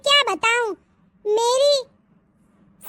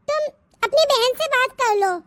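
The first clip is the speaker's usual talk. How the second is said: normal talking voice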